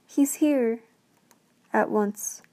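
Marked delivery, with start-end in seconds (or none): 0.1-0.8 high, loud excited call; girl spotting her father coming home. Acted. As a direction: call it out across the snow. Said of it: "she called".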